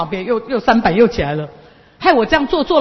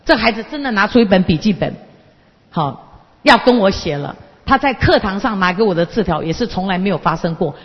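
ordinary talk

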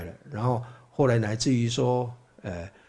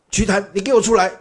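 higher, louder and fast, a retold angry shout; hammed-up furious summons; angry demand; 7 semitones higher, 6 dB louder, faster than usual. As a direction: demand he come out right now. Brahmin demands Gautama come out.

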